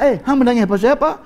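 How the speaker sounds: high, loud, baffled question; startled questioning. Acted. Man asking the woman why she cries, startled.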